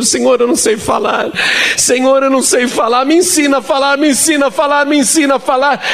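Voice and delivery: frightened, desperate high-pitched plea; desperate prayer. Retold or imitated. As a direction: beg God to teach you to speak, voice strained high. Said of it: "E eu tinha tanto medo".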